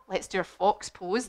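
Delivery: plain reading voice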